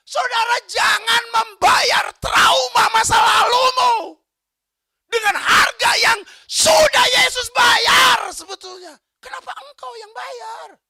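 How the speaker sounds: high, loud, slow impassioned appeal; preaching at full force. Real, not acted. Preacher pleading with the congregation, slow and loud. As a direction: thunder it at the congregation.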